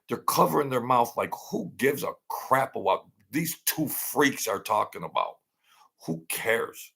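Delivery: ordinary delivery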